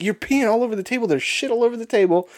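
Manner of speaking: high, exasperated telling-off; telling the drunk buddy he's peeing on the table; retold scolding. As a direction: call it out up high in disbelief.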